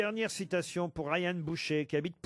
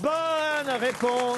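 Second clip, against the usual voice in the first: drawn-out announcement; 5 semitones higher, 6 dB louder, much slower than usual. Real, not acted. Host announces correct answer.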